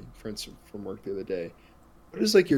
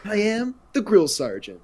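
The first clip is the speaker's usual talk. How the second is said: higher-pitched mock impersonation; Guy Fieri with PTSD; 10 semitones higher, 3 dB louder than usual. Quoting the sketch's punchline to friends, mock impersonation. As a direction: lightly play the sketch character. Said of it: "he plays Guy Fieri, but if he had P T S D".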